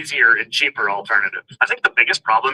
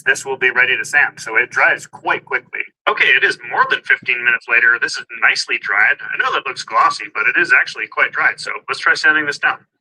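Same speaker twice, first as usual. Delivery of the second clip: ordinary delivery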